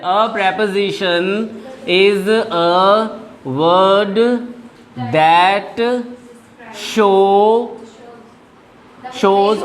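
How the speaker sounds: very slow, word by word; slow dictation. Shown as a demonstration. Teacher dictating the definition to students, very slowly. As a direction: dictate each word for the class to write. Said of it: "dictating a definition slowly".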